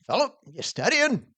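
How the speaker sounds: put-on high, drawn-up old preacher call. Old minister phoning his young quizzer, preacher voice. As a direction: imitate an elderly preacher checking in. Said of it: "He had this preacher voice".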